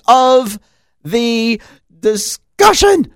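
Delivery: rising indignation. Real, not acted. Building rant about confidentiality.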